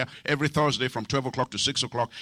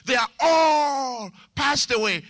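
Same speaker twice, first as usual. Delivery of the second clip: high, long-stretched "all"; slow declamation at raised pitch; sweeping proclamation; 8 semitones higher, much slower than usual. Retold or imitated. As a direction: draw out "all" emphatically. Mimics believers arguing from scripture.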